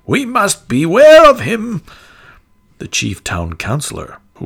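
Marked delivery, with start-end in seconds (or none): 0.1-1.8 high, loud frightened townsfolk cry; a crowd crying out in fear; alarmed cry. A narrator's reading. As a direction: shriek it out in alarm. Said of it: "cried the citizens with one voice".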